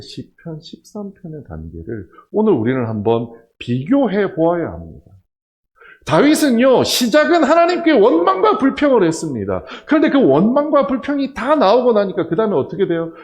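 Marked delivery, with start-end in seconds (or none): 6.0-13.1 loud, high pulpit preaching; full-force preaching. Acted. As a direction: drive it home to the congregation.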